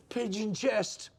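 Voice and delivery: slow schoolboy comeback; a hint of a sneering schoolmate; mock retort. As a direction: fire the insult back slowly. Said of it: "And he would go".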